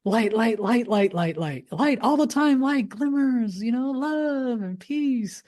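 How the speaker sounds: very high singsong parody of blissed-out positivity; mock your old relentlessly upbeat self in a squeaky-high voice; high-pitched gushing, stretched out at the end; spiritual bypasser. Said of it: "I was a spiritual bypasser".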